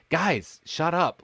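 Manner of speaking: mildly fed up, cutting off the chatterers; retold irritation. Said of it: "really ticks me off".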